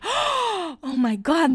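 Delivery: high, slow, drawn-out gasp of disbelief; breath-caught dramatic surprise; mock shock. Acting out her shocked reaction back then.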